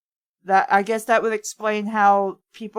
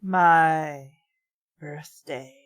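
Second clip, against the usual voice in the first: said slowly; 8 semitones lower, much slower than usual. A narrator's reading. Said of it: "said slowly".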